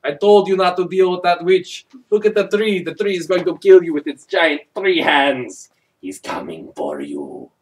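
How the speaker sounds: storyteller spooking the boy, hammed up; spooky warning voice. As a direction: warn the boy like a campfire ghost story.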